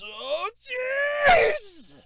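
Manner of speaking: high, strained climber's voice; climbing stairs. Pretend. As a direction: puff your way up the steps. Reaching the top, winded.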